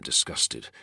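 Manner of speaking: usual speaking voice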